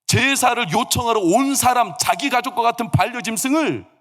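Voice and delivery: high, building pulpit intensity; impassioned preaching. Real, not acted. Preacher describing the worshipper's own sacrifice, impassioned. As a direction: build phrase by phrase toward a ringing peak.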